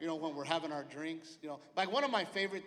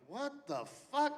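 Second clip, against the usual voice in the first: stunned what the fuck; 8 semitones higher, slower than usual. Retold or imitated. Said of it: "I'm like".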